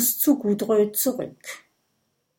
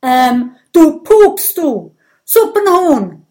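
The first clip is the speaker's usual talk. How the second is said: loud, much higher squealed insults; shouted insults; 6 semitones higher, 11 dB louder than usual. Acted. Gudröd firing insults at Brodier, high and loud. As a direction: shriek the insults in a cartoon voice.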